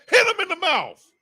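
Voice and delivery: very high-pitched rallying cry; fired-up cheer. Real, not acted. Fan urging defense against the run, fired-up cry. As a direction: holler it to spur the defense.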